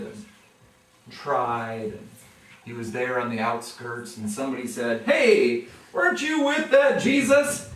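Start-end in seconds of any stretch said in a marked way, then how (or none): 5.0-7.7 loud, high calling out; accusing bystander. Retold or imitated. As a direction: catch him out, loudly.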